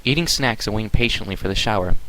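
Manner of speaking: plain reading voice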